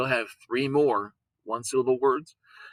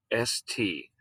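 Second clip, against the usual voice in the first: normal talking voice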